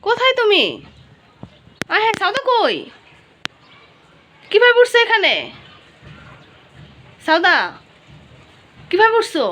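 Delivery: mother calling up loudly, startled. Real, not acted. Mother searching for her climbing toddler, calling out loud.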